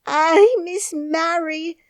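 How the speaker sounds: high, loud, slow frightened wail; frightened Yorkshire maid; half crying. A narrator's reading. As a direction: plead near tears. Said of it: "half crying".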